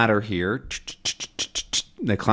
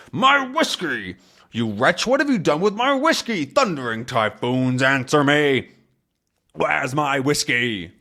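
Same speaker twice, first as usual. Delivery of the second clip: outraged sea captain; 5 semitones higher than usual. Acted. Haddock grabbing him by the collar, outraged. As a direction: demand answers as a furious storybook captain. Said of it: "Haddock is now angry".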